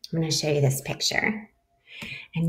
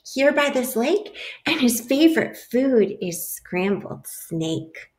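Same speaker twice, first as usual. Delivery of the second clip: lively picture-book read-aloud; animated storybook voice for a class; reading to children; 4 semitones higher than usual. A narrator's reading. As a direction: read it like story time for little kids.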